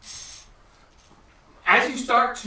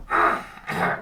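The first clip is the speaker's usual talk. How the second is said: kobold voice; 12 semitones lower than usual. Acted. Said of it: "I'm trying not to do the goblin voice".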